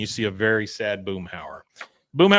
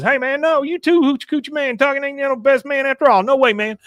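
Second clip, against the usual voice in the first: Patch impression; 13 semitones higher, 4 dB louder, faster than usual. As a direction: sneer it high and fast. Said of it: "then Patch says".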